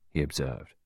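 usual speaking voice

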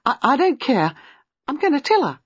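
high, loud and breathless, blurting it out; can't hold it in any longer, rushes it out; breathless outburst. A narrator's reading. Gwenda bursts out to Giles, breathless and loud. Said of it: "to say breathlessly to Giles".

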